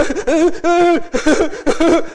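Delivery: long, loud, high mock wail; imitated sobbing. Preacher imitating his son weeping for half an hour, mock sobbing. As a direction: heave out hammed-up child sobs. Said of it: "They all started weeping".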